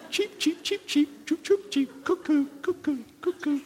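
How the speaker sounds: very high chirping cheep and cuckoo; bird calls. Pretend. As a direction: whistle it out like a little bird. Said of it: "Or in a different language".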